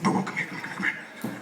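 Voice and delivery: hushed whisper calling over; whispering cashier. Retold or imitated. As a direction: whisper urgently, beckoning. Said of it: "He's like".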